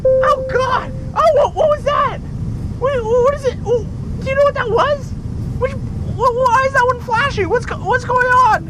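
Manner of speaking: frantic, voice way up in alarm; panicked pilot. Acted.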